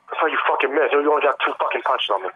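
ordinary talk, nothing marked

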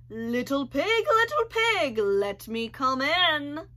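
booming storybook wolf voice; big bad wolf. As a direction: coax the pig out like a hungry wolf. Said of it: "he cried".

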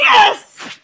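explosive loud cheer; triumphant shout. Real, not acted. Player takes the lead over opponent, shouting. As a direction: yell out the victory. Scores, shows competitive side.

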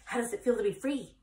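1 goose. Acted. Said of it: "she asked".

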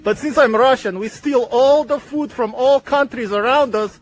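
hammed-up Russian accent, booming villain boasts; Nikolai Volkov. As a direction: stake a claim as a mock Russian villain, with evil laughs. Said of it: "I am Nikolai Volkov".

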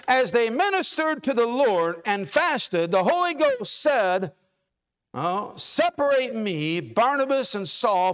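preacher reading Acts aloud to the congregation; scripture reading. Acted. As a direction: read it out with weight, like from the pulpit.

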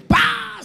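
loud, high explosive pop; sudden comic "pah"; popping noise. Acted. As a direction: burst it out like something shot free. Peanut pops out.

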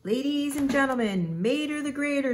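high, projected stadium announcer; screaming announcer. A narrator's reading. Announcer presents the stunt star to the stadium, screaming high. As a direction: introduce the star like a ringside announcer. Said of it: "screamed the announcer".

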